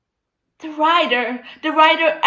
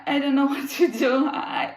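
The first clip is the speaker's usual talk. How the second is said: normal talking voice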